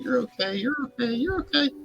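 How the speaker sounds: tiny squeaky flower voices; piping, shrill little chorus; high shrill tone. Acted. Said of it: "in a really high, shrill tone".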